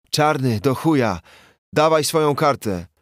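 high, loud furious yell; enraged commissioner shouting for the keycard. Acted. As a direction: bellow the order through the door. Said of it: "wrzeszczał bandura".

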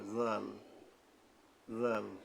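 জাল with the tongue at the upper front teeth, a soft voiced th; soft Arabic dhal. Shown as a demonstration. Teacher demonstrating the letter dhal, soft voiced th. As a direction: ease জাল out gently with a dh sound. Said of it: "নরমভাবে আদায় হবে".

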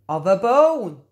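plain reading voice

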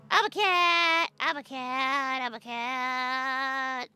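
high, drawn-out cat-like whine; mock feline yowl; silly cat voice. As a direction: stretch the words out like a yowling cat. Playing at being a cat.

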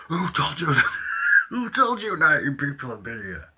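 high, loud imitation of an outraged boss; hammed-up boss protesting loudly; mock indignant boss. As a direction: play the boss, shrill and offended. Mimicking boss's indignant reply.

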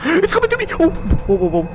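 very high, loud squeal of fright; real jump-scare panic; panicked shriek. Real, not acted. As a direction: shriek as it charges at you, then tumble into startled whoas. Monster charges at player.

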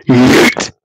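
loud, breathy scream of "Yeet". Shown as a demonstration. Streamer yells again for the chat, loud breathy shout. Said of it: "I've screamed it".

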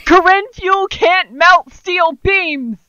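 high, loud, drawn-out holler; laughing, hammed-up shout; mock proclamation. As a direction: declare it slowly like a crackpot slogan. Shouted joke about bending steel bars.

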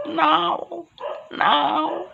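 high yowling cat call; silly dumb pet voice. Acted. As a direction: yowl back and forth like cats. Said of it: "coffee time".

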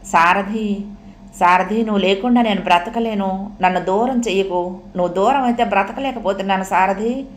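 usual speaking voice

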